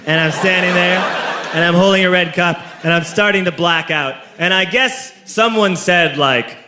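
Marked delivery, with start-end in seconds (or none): none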